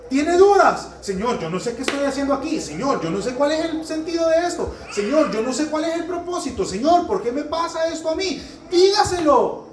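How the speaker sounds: high, fervent pleading prayer; impassioned preaching. Real, not acted. Preacher voices a doubting believer's prayer, high and fervent. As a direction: plead with God at full voice.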